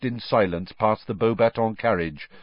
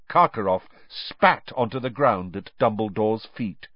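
ordinary delivery, nothing marked

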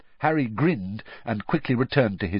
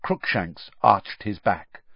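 ordinary delivery

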